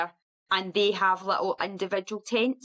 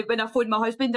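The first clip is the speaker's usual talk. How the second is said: normal talking voice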